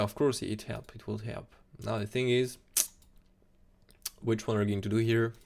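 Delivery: ordinary talk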